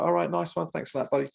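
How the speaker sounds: chirpy and keen to leave; fake-cheery thanks. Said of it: "And I was like".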